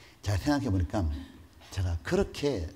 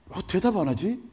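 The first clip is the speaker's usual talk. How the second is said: usual speaking voice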